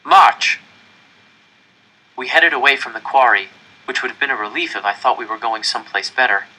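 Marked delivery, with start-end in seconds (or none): 0.0-0.6 loud, high one-word command; shouted order. Acted. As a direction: snap it out like a guard. Capo orders prisoners to march.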